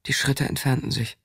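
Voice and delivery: ordinary delivery, nothing marked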